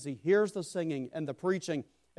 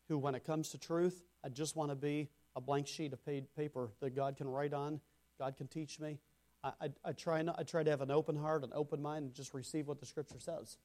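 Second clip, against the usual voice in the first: much softer, inward musing; hushed confession; 8 dB quieter than usual. Acted. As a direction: sink into a soft, humble confession. Nathanael's inner thought, hushed.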